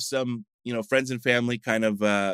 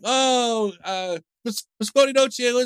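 high-pitched, animated mock Russian greeting; imitated Russian greeting; 11 semitones higher, 3 dB louder, slower than usual. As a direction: hail them as an excited Russian speaker, pitched way up. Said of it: "he starts speaking Russian to me".

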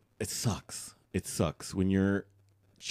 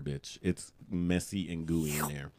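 usual speaking voice

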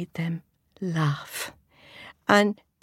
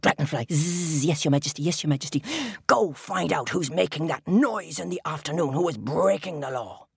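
crab king voice. Acted. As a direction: command like a grumpy king. Crab king orders dragonfly.

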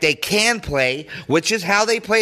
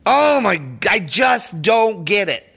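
usual speaking voice

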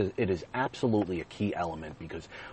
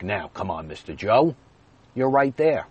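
mock salesman's urging, rising higher and louder; coaxing salesman; 3 semitones higher, 7 dB louder than usual. Manager pressing a reluctant buyer to agree, coaxing. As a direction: push for the deal, building in volume.

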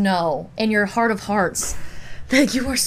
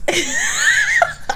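very high squeal of laughter; shrieking laugh. Real, not acted. Cracks up at the joke.

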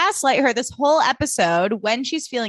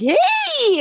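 high mock hey; 4 dB louder than usual. Quoting Max's greeting.

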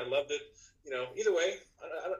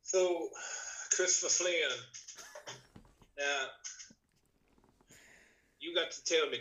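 put-on wrestler voice. Guest asking host his favorite match, in a favorite wrestler voice. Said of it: "in a voice that I like to do".

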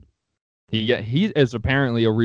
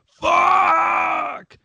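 loud, very high drawn-out mock scream; imitated scream; 5 dB louder than usual. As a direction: imitate a furious player screaming out a long curse. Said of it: "he came up screaming".

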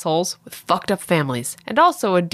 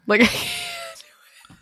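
ordinary talk, nothing marked

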